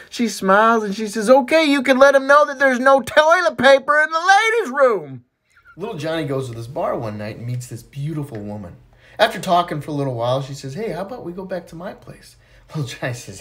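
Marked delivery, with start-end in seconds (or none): 1.3-5.2 woman's punchline. Acted. 10.7-12.4 soft invitation. Acted. Woman invites Johnny softly.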